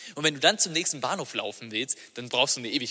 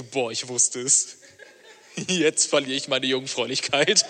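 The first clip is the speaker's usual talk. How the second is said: mock dread; 3 semitones lower, slower than usual.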